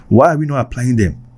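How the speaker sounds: normal talking voice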